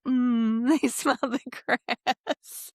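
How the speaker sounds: slow, high, blissful sniffing voice; dreamy cooing. Retold or imitated. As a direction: coo it out slowly, enchanted. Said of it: "then she's like".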